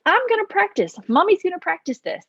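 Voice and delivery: put-on mom voice to kids. Mom practicing in front of her kids, high sing-song voice. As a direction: play a cheery mom announcing to her kids. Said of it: "just be like".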